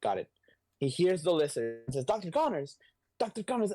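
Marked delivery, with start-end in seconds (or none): 2.1-3.7 high-pitched, pleading mock call; urgent calling out. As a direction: try to get through to an attacker. Acts Spider-Man calling to the Lizard.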